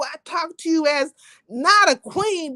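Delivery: usual speaking voice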